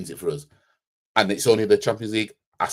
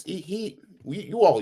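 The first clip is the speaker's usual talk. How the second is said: ordinary talk, nothing marked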